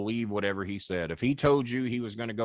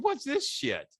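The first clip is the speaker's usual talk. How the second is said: mock outraged, pitched way up; indignant; slower than usual. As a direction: demand an answer from Cornette. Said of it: "I said".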